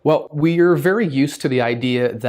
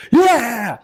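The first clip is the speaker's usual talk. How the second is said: high, loud happy yeah; hammed-up joy; mock excited cheer; 11 semitones higher, 5 dB louder than usual. Shown as a demonstration. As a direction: cheer like you just won. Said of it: "a positive version of being angry".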